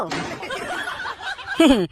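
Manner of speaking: a short breathy laugh; amused burst of laughter. Real, not acted. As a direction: laugh at the clever twist. Friend laughs at the joke.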